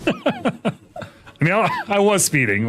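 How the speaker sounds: high laugh into words. Real, not acted. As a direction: laugh while owning up. Laughs admitting he sped.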